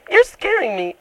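very high, put-on frightened whine; feigned fright. Prank caller tells tradesman he is scary, high mock-frightened whine. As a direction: squeal it like a hammed-up victim.

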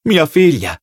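understated pride, pitch lifted then falling; a touch of sad pride; mournful pride. A narrator's reading. Parsons names his daughter as informer, with mournful pride. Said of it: "con una sorta di orgoglio mesto".

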